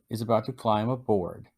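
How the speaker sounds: ordinary talk, nothing marked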